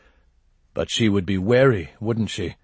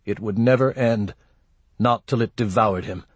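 plain reading voice

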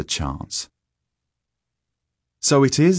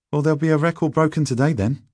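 high, quick schoolboy boast; cocky schoolboy; 10 semitones higher, 4 dB louder, faster than usual. A narrator's reading. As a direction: pitch it up and rattle it off as a boy. Narrator voices Stanley.

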